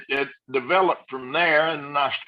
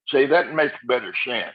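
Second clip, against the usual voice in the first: ordinary talk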